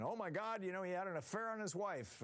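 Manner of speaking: mock outrage. Mocking scandal-hungry media.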